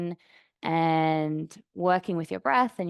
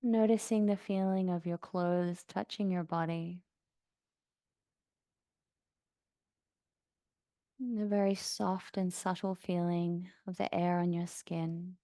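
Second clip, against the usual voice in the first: soft, even meditation voice with long pauses; guided meditation; 4 dB quieter than usual. Acted. Yoga teacher guiding class to notice sounds around them, calm meditation voice. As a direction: settle them gently into stillness, unhurried.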